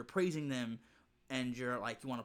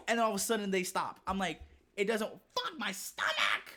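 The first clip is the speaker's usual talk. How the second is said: ordinary talk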